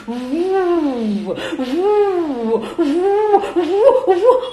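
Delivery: rising whooshing wind sound; playful wind noise for kids. Acted. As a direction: blow like a gusting wind. Storyteller voices the wind.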